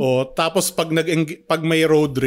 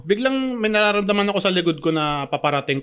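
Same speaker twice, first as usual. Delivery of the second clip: ordinary talk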